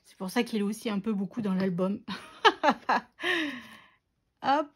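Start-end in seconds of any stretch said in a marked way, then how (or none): 2.4-3.6 short, higher burst of laughter; real giggly chuckle; amused laugh. Real, not acted. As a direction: laugh at your own admission. Laughs at her own remark.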